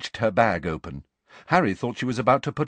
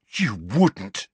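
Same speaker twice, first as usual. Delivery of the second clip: changed voice, shocked and horrified. A narrator's reading. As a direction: recoil in alarm.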